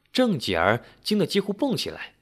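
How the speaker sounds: ordinary delivery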